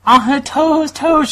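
high mocking echo of the singer; mimicking the singer. Comedian mocking how she gets into it, high voice. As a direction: squeal the lyric in imitation.

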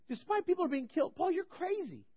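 incredulous. Acted. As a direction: sound amazed it could be true. Mock disbelief at Paul's claim.

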